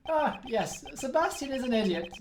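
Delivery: ordinary delivery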